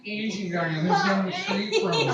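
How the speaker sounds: easygoing surfer-dude reply; lightly voiced Owen Wilson. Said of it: "Owen Wilson".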